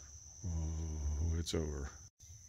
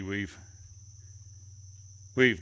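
usual speaking voice

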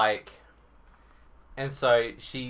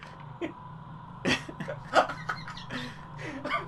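breathless laugh; 12 semitones higher, 9 dB quieter than usual. Real, not acted. Laughing fit after joke.

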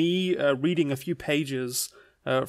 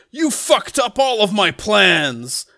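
loud, drawn-out angry yell; Shadow; 6 semitones higher, 7 dB louder, slower than usual. Acted. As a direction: rage slowly at the one who ruined everything. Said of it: "And then Shadow goes".

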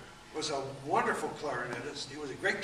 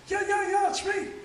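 much higher, louder imitated voice; 8 dB louder than usual. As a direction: play the little man calling, pitched way up. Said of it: "He said".

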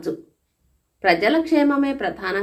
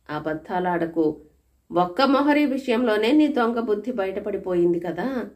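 ordinary delivery

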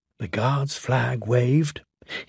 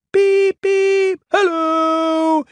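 much higher piping whistle voice; cheerful whistle; 4 dB louder, much slower than usual. Acted. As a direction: toot it out brightly like a train whistle. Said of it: "he whistled cheerfully".

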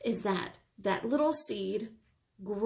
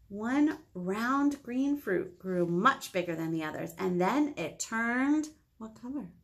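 usual speaking voice

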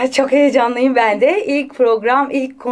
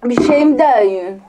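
Greek-Turkish accent. Shown as a demonstration. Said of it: "onu yapıyorduk değil mi".

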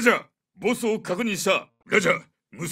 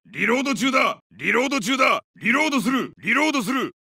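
higher, raised combat call; urgent reload callout; 4 semitones higher than usual. Acted. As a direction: alert teammates while under fire. (戦闘中の張った声)

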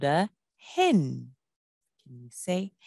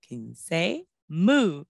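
usual speaking voice

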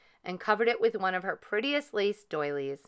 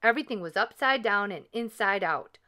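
ordinary delivery

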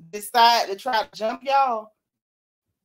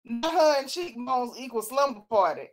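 ordinary talk

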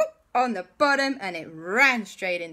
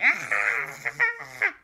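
dinosaur yelp; 6 semitones higher than usual. Acted. Dinosaur caught in net.

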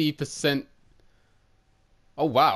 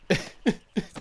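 silent giggle; airy laugh; breathy laugh; 6 semitones higher than usual. Real, not acted. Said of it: "I can't stop laughing at the moment".

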